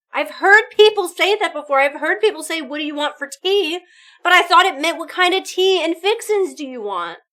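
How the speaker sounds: loud, high, worked-up telling; bursting to share the realisation; excited disbelief. Real, not acted. Streamer telling chat about the tea mix-up, loud and excited.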